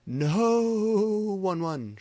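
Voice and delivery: high loud scream of no. A narrator's reading. Prep lady screaming under attack, high loud scream. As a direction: shriek as she is attacked. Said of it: "she screamed".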